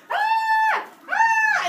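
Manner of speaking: shrieking tooth, hammed up; high mock scream. Voicing the tooth for the kids, high mock shriek. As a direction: squeal way up high like a scared tooth.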